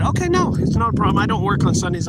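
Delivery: eager reassurance. Retold or imitated. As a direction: jump in eagerly to reassure her. Eagerly agreeing to Jen's request.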